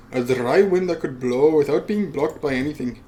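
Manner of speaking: ordinary delivery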